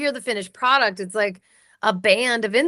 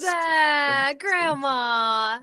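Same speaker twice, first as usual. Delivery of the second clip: touched; 7 semitones higher, slower than usual. Real, not acted.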